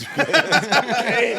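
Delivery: high, slow laughing admission; laughing about his cocaine years. Real, not acted. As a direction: laugh through the confession. Laughing recalling eighties drug use.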